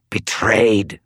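a hint of a snarling ghoul. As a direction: spit the word like a beast. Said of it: "the ghoul snarled".

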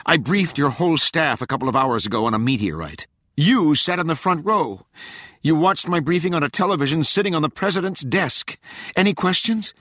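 high, loud, exasperated; fuming. A narrator's reading. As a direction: hurl it back at her, fuming. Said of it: "Rachel fumed".

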